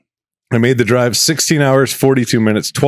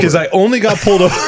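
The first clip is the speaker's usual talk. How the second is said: higher, giggling through the line; laughing; 8 semitones higher than usual. Real, not acted. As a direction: let a laugh break into it. Laughing while telling the story.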